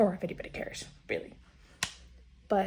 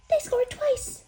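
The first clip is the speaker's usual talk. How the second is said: much higher, louder thrilled cry; excited squeal; 4 dB louder than usual. Real, not acted. Hockey fan squealing that Montreal scored twice. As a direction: squeal it out in delight.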